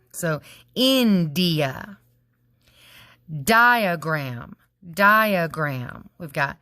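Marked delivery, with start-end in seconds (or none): 0.7-2.0 India drawn out slowly, in-di-a; slow syllables. Shown as a demonstration. Teacher to class, repeating India slowly in syllables. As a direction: stretch India into three slow beats. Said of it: "dividing it into three syllables".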